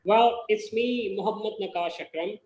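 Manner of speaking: usual speaking voice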